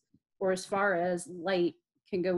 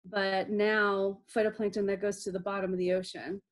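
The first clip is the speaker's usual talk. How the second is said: usual speaking voice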